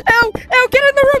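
high, urgent call to get to safety; panicked order. Real, not acted. Ordering teammate away from danger.

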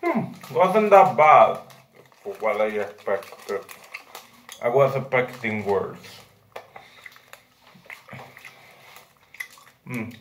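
speaking around food in mouth; chewing while talking. Real, not acted. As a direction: mouth partly full. Seen 2: Holds candy bag, jaw and lips move as chewing throughout.

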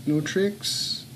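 normal talking voice

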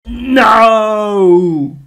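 loud, high cry of real dismay; frustrated shout. Real, not acted. Player yelling at the game as it fails, loud frustrated shout. Something goes wrong in game.

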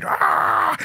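high-pitched imitated attack yell; mock battle cry. Imitating friend throwing a haymaker, high battle cry. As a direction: let out a high charging cry.

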